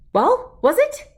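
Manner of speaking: high, loud, eager question; can't wait for the answer; eager demand. A narrator's reading. Dog runs to the tree and asks eagerly, high and loud.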